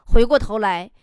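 ordinary delivery

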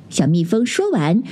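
usual speaking voice

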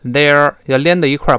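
normal talking voice

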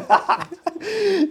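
high-pitched burst of laughter. Real, not acted. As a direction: laugh up high, then let it fall. Amused by affordable price.

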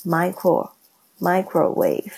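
"microwave" spoken slowly and split; slow modelling. Shown as a demonstration. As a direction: break "micro-" off, then the whole word. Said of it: "这个wave是波的意思".

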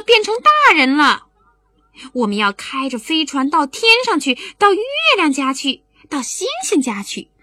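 put-on high, loud eager boy; excited child. Boy telling mother they'll fly to the moon, excited child. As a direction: burst out with the big news.

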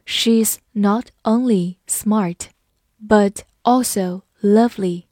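slow, separated words; slow reading. Shown as a demonstration. Teacher modelling not only but also, read slowly. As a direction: read it word by word, unhurried. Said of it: "我们慢读一遍".

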